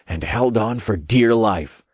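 usual speaking voice

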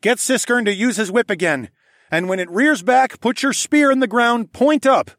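high, loud battle plan; urgent instructions. A narrator's reading. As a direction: call out each step to your partner. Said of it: "I explained".

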